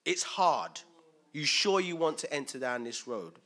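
preaching emphasis. Real, not acted. Preacher warns the congregation about the cost, loudly. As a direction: press the warning home.

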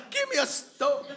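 high laughing mock mob demand. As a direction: demand a stone while laughing. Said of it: "It's like".

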